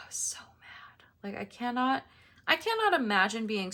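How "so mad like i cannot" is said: whispered frustration. Real, not acted.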